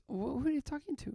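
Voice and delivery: high, lilting imitation of an odd nurse. Nurse asks patient who he talks to, imitated in high voice. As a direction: play a nurse with weird energy, pitched well up. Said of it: "she had a weird energy to her".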